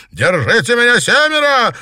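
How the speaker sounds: high, loud enraged yell; furious. A narrator's reading. Girl insulted by rival over her size, furious yell. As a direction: explode into a screeching rage. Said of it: "разъярилась Ирина".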